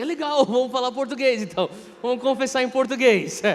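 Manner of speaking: laughing, excited agreement; laughing excitement. Retold or imitated. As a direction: catch the priest's enthusiasm. Answering the priest's enthusiasm.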